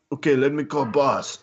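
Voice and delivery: amateur mock Russian accent; imitated Russian accent. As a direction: imitate a Russian host with little English. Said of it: "Very thick Russian accent".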